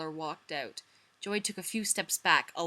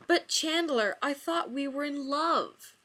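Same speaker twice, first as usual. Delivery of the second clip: heartbroken; 8 semitones higher, 5 dB louder, slower than usual. Acted. Joey learns he is dumped.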